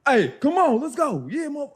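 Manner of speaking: high, loud retold sideline hype; talking crazy. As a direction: act out a turned-up bench egging on the team. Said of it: "we talking crazy".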